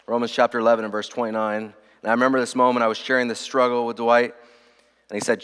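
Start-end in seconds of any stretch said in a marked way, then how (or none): none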